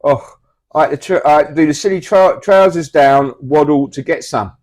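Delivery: loud eager anecdote; loud storytelling. Acted. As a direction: tell it loudly and boldly. Loud toilet paper story.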